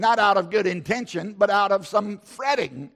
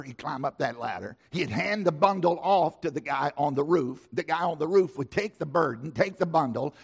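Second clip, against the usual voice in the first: ordinary talk, nothing marked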